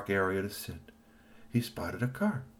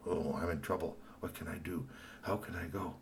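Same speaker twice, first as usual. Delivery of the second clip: worried hush; 2 semitones lower, 10 dB quieter than usual. Acted. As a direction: fret softly under your breath. Kashikoi worried, guarded.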